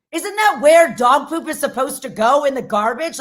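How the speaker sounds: normal talking voice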